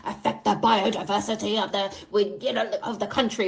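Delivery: lightly imitated grave officials; a hint of solemn officialdom; serious customs officers. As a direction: recite the warning with mock gravity. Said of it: "they were still so serious".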